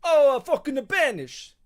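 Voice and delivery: very loud, high mock Italian shout; "banish" stretched out slowly in a hammed-up Italian accent; over-the-top Italian accent, shouting. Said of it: "I know I'm not an Italian guy".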